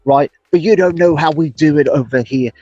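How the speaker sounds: mock English bloke voice greeting a British viewer; English accent mode. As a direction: put on a cheeky English accent, laying it on thick. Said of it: "I went right into English accent mode for him".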